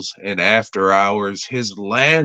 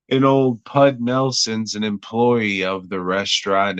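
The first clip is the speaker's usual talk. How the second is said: ordinary talk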